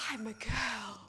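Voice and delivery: breathed-out amazement in a put-on voice; awed exclamation. Madeline sees herself young in the mirror, awed. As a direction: marvel at your own reflection. Acts Madeline marvelling at her reflection.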